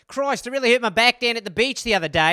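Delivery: a hammed-up Australian soap voice; mock Australian accent. Aussie soap character complaining about his back, in Australian accent.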